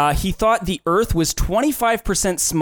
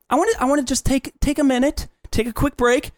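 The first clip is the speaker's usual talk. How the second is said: high, fast, overexcited plug; hammed-up high excitement; 9 semitones higher than usual. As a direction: oversell the sponsor break. Hammed-up sponsor break.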